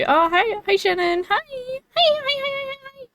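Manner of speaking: very high, squealed hellos; real high-pitched fangirl welcome; excited greeting. Real, not acted. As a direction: greet a friend in a rising, delighted squeal. Greets viewer Shannon who just arrived.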